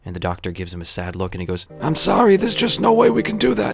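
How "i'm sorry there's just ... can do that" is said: high, loud comic rasp; strange raspy voice. Acted. Doctor refusing to give it back, high raspy voice. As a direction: deliver the punchline in a loud, high, raspy put-on voice. Punchline: doctor has the patient's odd voice.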